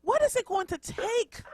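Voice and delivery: high-pitched exasperated outcry; fired up. Real, not acted. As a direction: cry out high, fed up and worked up. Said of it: "They got you fired up".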